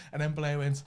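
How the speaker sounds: normal talking voice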